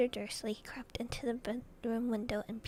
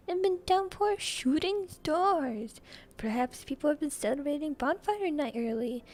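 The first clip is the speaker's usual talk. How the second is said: high, loud TV weatherman; hammed-up weather forecaster with a put-on accent; Jim's voice; 4 semitones higher, 7 dB louder than usual. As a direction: belt out the forecast in a raised character voice. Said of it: "I had to do Jim's voice".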